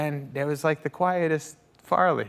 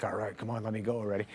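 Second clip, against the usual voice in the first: impatient mutter; 7 semitones lower, 11 dB quieter, faster than usual. Retold or imitated. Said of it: "feel Farley's energy".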